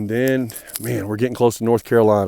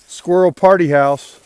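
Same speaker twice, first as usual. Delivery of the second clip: excited announcement; 7 semitones higher, 8 dB louder than usual. Real, not acted. Showing off a squirrel tree.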